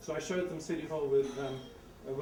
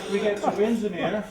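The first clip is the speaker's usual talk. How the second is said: emphatic arrival; 3 semitones higher, 10 dB louder than usual. Real, not acted. Exclaimed arrival.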